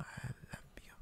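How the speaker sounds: very soft, whispered endearment; loving whisper. Pretend. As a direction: whisper it tenderly to a partner. Tender goodbye to partner. (bisikan sayang yang lembut)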